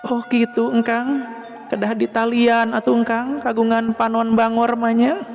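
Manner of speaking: high female voice with a smile; smiling, teasing woman. A narrator's reading. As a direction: tease gently in a high feminine voice. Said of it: "harita teh bari mesem". (sora istri luhur bari mesem)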